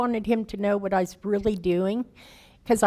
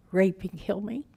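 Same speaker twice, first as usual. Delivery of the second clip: normal talking voice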